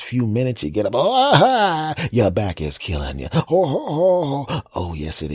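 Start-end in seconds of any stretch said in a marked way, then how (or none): 0.9-1.9 high, loud mock groan of a stiff back; old-man morning groan; pained groan. Groaning on getting up. 3.3-4.6 high, loud mock moaning; stiff-back morning moans; pained moans. Groaning on getting up.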